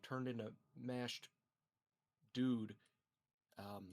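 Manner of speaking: normal talking voice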